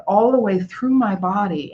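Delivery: ordinary talk, nothing marked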